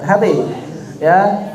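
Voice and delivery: ordinary delivery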